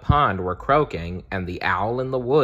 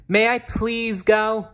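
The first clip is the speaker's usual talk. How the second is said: high, slow, small cub voice; a young bear pleading sweetly; little bear asking; 14 semitones higher, 4 dB louder than usual. Acted. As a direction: Bear asks his parents if he may go. Bear asks parents permission.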